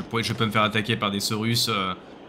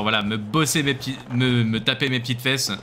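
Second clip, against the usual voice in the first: normal talking voice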